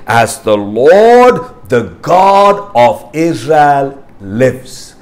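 slow, deliberate, each phrase weighted; slow emphatic scripture read. Shown as a demonstration. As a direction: read it slowly, leaning on Lord and God. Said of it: "then note in your Bible".

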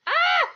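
sudden high scream. Real, not acted. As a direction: shriek in fright. Attacked in the game.

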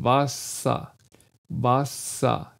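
WASSER with a weakened A-like ending; WASSER, long S and softened vocalic end; weakened final vowel. Shown as a demonstration. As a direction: say WASSER in two careful syllables, no R. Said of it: "der Mund schließt sich leicht".

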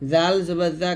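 ordinary delivery

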